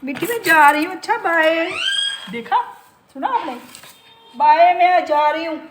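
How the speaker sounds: loud high pet call; calling out to parrot. Real, not acted. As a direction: sing out bye to the bird from the door. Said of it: "अब देखना जैसे ही मैं दरवाज़ा खोल के".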